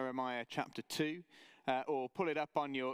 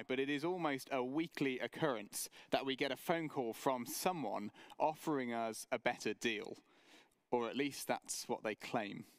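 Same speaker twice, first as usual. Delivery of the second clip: normal talking voice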